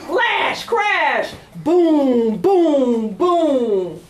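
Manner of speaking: dramatic thunder refrain; thunder booms. Acted. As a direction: shout the storm noises.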